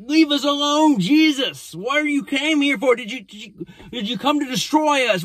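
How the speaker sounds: high-pitched, agitated demon protest; mock-panicked high demon voice; yelling unclean spirit. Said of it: "he starts yelling".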